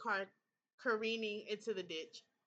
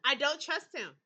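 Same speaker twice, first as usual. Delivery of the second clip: emphatic, louder restatement; loud distrust; 4 semitones higher, 9 dB louder than usual. Real, not acted. Player repeats her distrust of Thomas, loudly. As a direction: hammer the point home.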